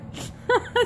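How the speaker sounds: squealing laugh from being tickled; breathless high giggle. Real, not acted.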